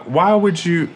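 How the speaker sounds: normal talking voice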